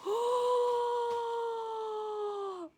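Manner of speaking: long, high, soft gasp; drawn-out gasp of wonder; amazed gasp. Real, not acted. First sight of a gift abalone, long awed gasp.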